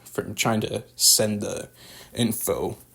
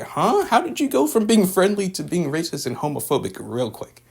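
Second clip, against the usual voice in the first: high-pitched, baffled questioning; exaggerated bewilderment, pitched high; incredulous; 8 semitones higher than usual. Real, not acted. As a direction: ask it in disbelief, voice jumping up. Baffled at a sudden change of heart.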